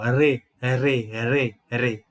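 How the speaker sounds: Re, re with the tongue-tip R, not from the throat. Shown as a demonstration. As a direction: tap the R in Re re re re. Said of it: "hoje em dia, você usa a língua mesmo".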